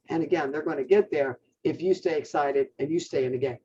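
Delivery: ordinary talk, nothing marked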